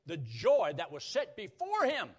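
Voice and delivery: high, loud, driving delivery; full-force preaching. Real, not acted. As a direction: proclaim it with mounting force.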